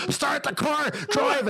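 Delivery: mock-frantic, climbing shriek at moderate volume; imitated screaming. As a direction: squeal the order like a panicking passenger. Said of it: "screaming at me".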